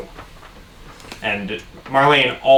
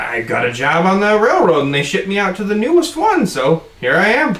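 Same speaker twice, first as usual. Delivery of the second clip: lightly voiced chatty bartender; 6 semitones higher than usual. Bartender explains his job.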